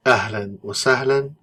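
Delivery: slow, careful Arabic ahlan wa sahlan; teacher modelling Ahlan wa sahlan; Arabic greeting. Shown as a demonstration. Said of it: "This is how the first group sounds".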